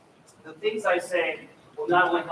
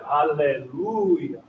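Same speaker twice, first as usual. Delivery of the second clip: slow Alleluia, pushing on LU; drawn-out Alleluia with a swell on the third syllable; push and pull; 4 semitones lower, much slower than usual. Shown as a demonstration. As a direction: stretch and stress the LU in Alleluia, then let go. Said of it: "we make certain syllables louder".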